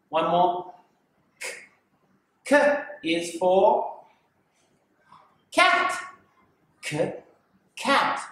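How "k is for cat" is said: stretched K sound, cat sung high; k sound demo. Shown as a demonstration. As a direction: draw out the hard k in cat. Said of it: "Can you hear the k sound?".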